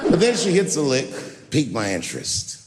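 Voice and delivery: ordinary talk, nothing marked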